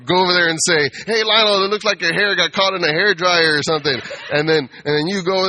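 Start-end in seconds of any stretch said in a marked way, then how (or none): 1.1-4.0 high, loud, mocking devil taunt; loud needling insult; annoying voice. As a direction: jeer at Lionel's hair like a pesky demon. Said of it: "in an annoying voice like that".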